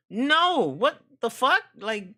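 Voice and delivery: slow, loud and high disbelief; mock outrage at sunny summer claim, slow and loud; outraged disbelief. Real, not acted. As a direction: drag it out in protest.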